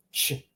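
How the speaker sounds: "ch" with tongue low in the mouth; lone light "ch", tongue kept down; soft Croatian ch. Shown as a demonstration. Teacher showing the soft sound, soft ch. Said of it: "to the bottom of your mouth".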